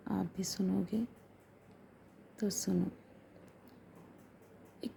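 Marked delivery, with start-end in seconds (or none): 0.1-2.8 soft, hushed, drawing the listener in; hushed invitation. Real, not acted. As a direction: lean in and whisper the invitation. Intimate invitation to hear story. (धीमी फुसफुसाहट में बुलावा)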